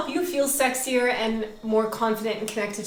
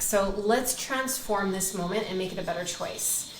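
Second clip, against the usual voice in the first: normal talking voice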